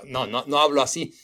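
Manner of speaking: ordinary talk, nothing marked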